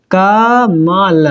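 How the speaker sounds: कमल stretched very slowly, syllable by syllable; slow syllable split. Shown as a demonstration. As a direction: draw कमल out for the pupils. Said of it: "अब इन सभी का आप बारी बारी से". (कमल को बहुत धीरे खींचकर)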